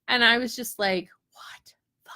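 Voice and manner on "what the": voiceless whispered disbelief; stunned whisper. Retold or imitated. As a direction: mouth it almost silently, aghast. Retold stunned reaction to kids' meltdown.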